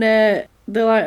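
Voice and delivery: ordinary talk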